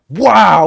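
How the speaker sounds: explosive, high imitated bang; sudden shouted whoosh of a door flung open; loud door-burst noise. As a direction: blast it out like a door crashing.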